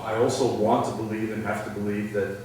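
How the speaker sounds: normal talking voice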